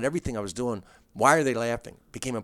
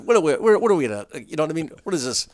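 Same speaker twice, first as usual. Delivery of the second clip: higher, louder exasperated scolding; exasperated retort; 4 semitones higher, 6 dB louder than usual. Retold or imitated. Comic telling his gawking friend off, higher exasperated retort. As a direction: scold him like he's being ridiculous.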